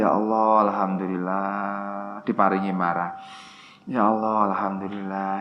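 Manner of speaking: quiet calming dhikr; calm murmured prayer. Shown as a demonstration. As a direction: settle down and pray it softly. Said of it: "sadari, disadari".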